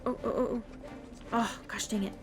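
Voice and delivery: ordinary delivery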